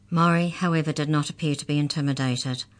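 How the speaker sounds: plain reading voice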